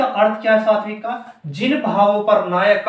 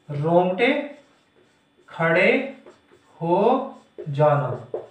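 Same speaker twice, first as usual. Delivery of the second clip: slow dictation; 3 semitones lower, slower than usual. Shown as a demonstration. Teacher dictating the meaning of रोमांच, slow dictation. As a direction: separate words with long pauses.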